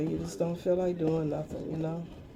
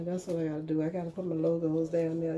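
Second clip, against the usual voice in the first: usual speaking voice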